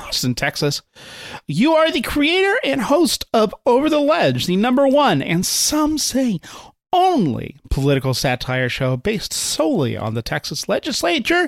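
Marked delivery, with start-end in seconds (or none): 1.5-11.5 high, swooping emcee build to a big finish; announcer introduction. Acted. Host bringing the guest on to applause, grand announcer delivery. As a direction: hype the guest, stretching the key words.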